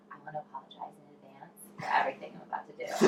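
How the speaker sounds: quick, low whisper; hushed apology. Retold or imitated. Nervous performer to front-row man before starting, hushed quick whisper. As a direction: whisper it confidentially, in a rush.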